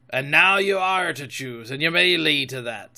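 pirate captain laying down terms; firm, punchy sea-cook voice; Silver, firm pirate. Acted. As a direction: voice a commanding old sea dog. Silver answers Jim.

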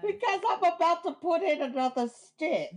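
hammed-up southern cook; mock southern cook. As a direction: cackle it like a butter-mad hostess. Continuing the Paula Deen bit.

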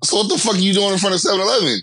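high, quick acted-out questioning; incredulous questioning. Man questioning the stranger in his car, incredulous.

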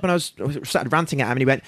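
ordinary talk

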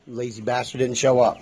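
ordinary talk

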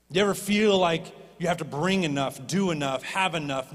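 normal talking voice

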